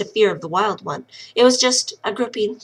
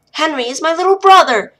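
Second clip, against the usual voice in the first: higher and louder, pushing back hard; upset protest; 7 semitones higher, 8 dB louder than usual. Acted. Lil insists to the wild woman that Henry is her brother, higher and loud. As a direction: insist through rising upset.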